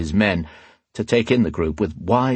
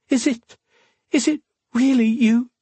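put-on very high, small girl's voice; halting, high-pitched disbelief; disbelieving little girl. Lost girl finds Alesh alive.

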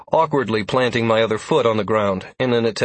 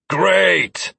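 bitter, higher and louder; spat out angrily; 8 semitones higher, 4 dB louder than usual. A narrator's reading. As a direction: spit the word out. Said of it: "Lazarus spat".

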